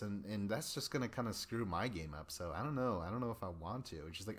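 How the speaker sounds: ordinary delivery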